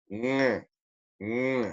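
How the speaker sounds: "Mm" as a velar ng hum through the nose; nasal NG. Shown as a demonstration. Teacher isolating the NG for students, nasal hum. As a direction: hum "Mm, mm" with the tongue back. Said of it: "Es un sonido nasal".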